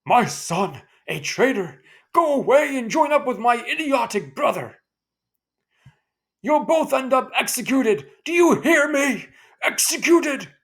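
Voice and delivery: loud, high furious shouting; father screaming at his son. Acted. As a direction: rage at the son who betrayed him. Grandfather yelling at traitor son.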